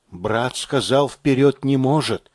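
plain reading voice

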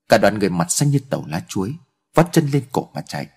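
ordinary delivery

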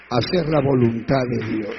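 emphatic, full-voiced conclusion; loud preaching. Real, not acted. Preacher drives home obedience to God, loudly proclaimed.

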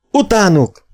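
rally the posse with a cry; shouted. A narrator's reading. The blacksmith urges the men to pursue, loud high shout. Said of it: "Kiáltotta Croft, a kovács".

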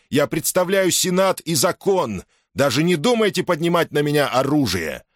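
high, ringing threat to soldiers; commanding general's warning. Acted. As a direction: warn them like a stern general. Caesar's voice from afar.

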